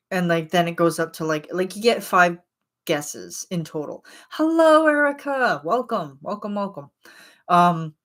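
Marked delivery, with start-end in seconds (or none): 4.3-6.9 excited greeting. Real, not acted. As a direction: call out a high-pitched welcome. Calling out a greeting to viewer.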